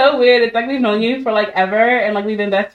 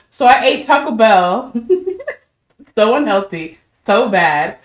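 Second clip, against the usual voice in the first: ordinary delivery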